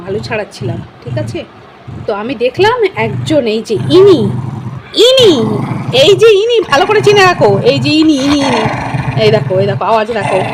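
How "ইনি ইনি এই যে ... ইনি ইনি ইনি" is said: loud, raised call pointing out the culprit; mock accusation. Owner showing everyone the pet thief, mock accusing.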